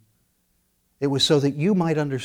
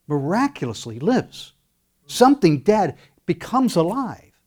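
normal talking voice

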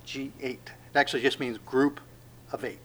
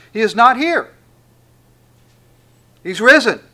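proclaiming loud; 7 semitones higher, 10 dB louder than usual. Acted. Proclaiming the resurrection text.

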